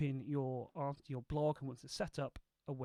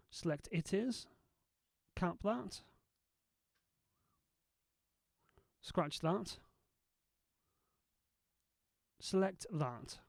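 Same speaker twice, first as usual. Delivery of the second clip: dictation commands. Shown as a demonstration. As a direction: dictate each word clearly to software. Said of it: "edit this with nothing more than my voice".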